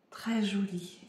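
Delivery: whispered compliment. Real, not acted. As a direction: breathe it softly. Admire en chuchotant. (compliment chuchoté)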